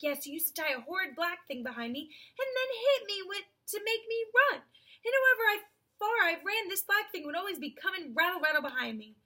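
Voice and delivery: lightly voiced horse, getting worked up; aggrieved horse. A narrator's reading. Horse recalling the cart behind him, aggrieved.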